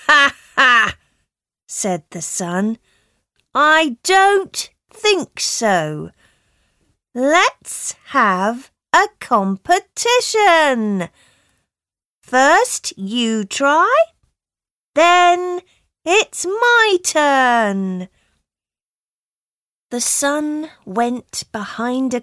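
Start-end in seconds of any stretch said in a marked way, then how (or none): 0.1-1.0 mocking laugh. Sun laughs at the wind. 3.5-18.1 bright and sure of herself, higher; the sun taking up the challenge; confident, high sun voice. Acted.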